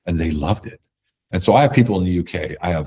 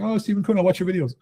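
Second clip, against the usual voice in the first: excited fan; 13 semitones higher than usual. Retold or imitated. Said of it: "He's like".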